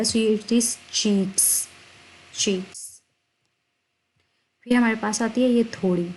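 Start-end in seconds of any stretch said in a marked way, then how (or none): 0.0-3.0 cheeks said very slowly with a clear ch sound at the start. Shown as a demonstration. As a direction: stretch out cheeks, ch as in chair. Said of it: "इस चे की sound बनाएगा". (cheeks को धीरे-धीरे, साफ़ "च" के साथ)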